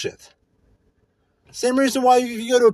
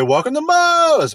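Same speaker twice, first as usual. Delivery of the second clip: greeter; 8 semitones higher, 5 dB louder than usual. Retold or imitated. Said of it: "they're supposed to say".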